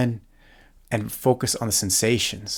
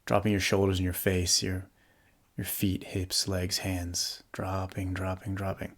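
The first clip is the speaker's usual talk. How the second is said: calm, softly settling meditation voice with long pauses; guided meditation; 4 dB quieter than usual. Real, not acted. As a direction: guide them gently into the body, leaving space to breathe.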